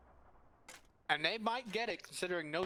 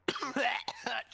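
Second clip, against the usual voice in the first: coughing on a crumb; sudden choked cough; choking cough; 8 semitones higher than usual. Real, not acted. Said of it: "Choking on Pringles here".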